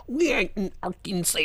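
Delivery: hammed-up movie hillbilly Southern; mock Southern accent. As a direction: mimic a badly coached Southern accent. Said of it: "and they're like".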